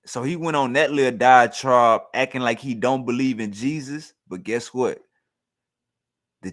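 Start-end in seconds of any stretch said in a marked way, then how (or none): none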